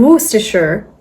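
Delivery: Worcestershire in an American accent, wuss-ter-sher with the r. Shown as a demonstration. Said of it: "Or in an American one".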